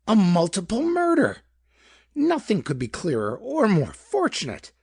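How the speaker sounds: high, gleeful cry about the murder; enthusing. A narrator's reading. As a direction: relish it like wonderful news. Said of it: "Mister Peck enthused".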